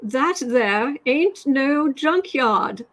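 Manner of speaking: slow, drawn-out mock West Virginia twang; mocking the new girl from West Virginia in a slow, drawn-out twang; mock hillbilly drawl. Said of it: "he said".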